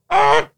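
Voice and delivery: high, loud wordless cry of frustration; frustrated yell. Retold or imitated. Tired parent snapping at partner, a wordless frustrated yell. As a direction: blurt out pure exasperation. Exasperated parent cannot find words.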